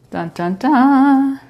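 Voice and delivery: slow drawn-out mock fanfare; dramatic dun dun dun. Baker unveiling the turbinado sugar with a slow mock-dramatic dun dun dun. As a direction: announce the sugar like a big reveal.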